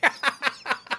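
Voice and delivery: high, light giggle; soft high-pitched chuckle in short bursts; quick quiet tittering; laugh. Real, not acted. Laughs after the yellow flower line.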